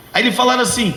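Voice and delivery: ordinary delivery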